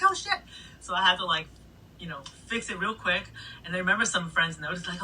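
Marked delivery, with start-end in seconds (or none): 0.0-0.4 high, sudden "oh shit"; panicked realization, pitched higher; startled curse. Retold or imitated. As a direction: blurt it as the mix goes wrong. Drops came in wrong.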